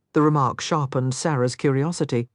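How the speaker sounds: usual speaking voice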